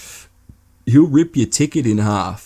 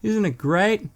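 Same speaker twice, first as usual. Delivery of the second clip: lightly higher, cheery god voice; god cheerfully explaining heaven has no heaters; God's voice; 7 semitones higher than usual. Retold or imitated. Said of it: "He's like".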